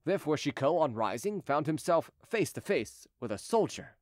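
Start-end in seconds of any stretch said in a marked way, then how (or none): none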